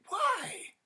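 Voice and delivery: a very high, sharp startled why; baffled and frightened; bewildered. Acted. Husband asks his wife why, very high and startled.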